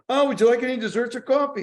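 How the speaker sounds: waitress. Retold or imitated. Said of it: "the waitress goes".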